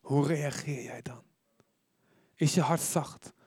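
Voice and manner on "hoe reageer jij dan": whisper. Shown as a demonstration. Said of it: "Is niet goed voor de opname".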